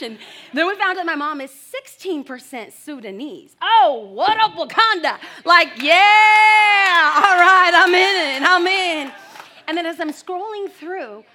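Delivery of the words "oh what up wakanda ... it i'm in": much louder, higher hype shout; excited shout. Retold or imitated. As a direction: whoop it up like a proud fan. Excited reaction to Sudanese heritage.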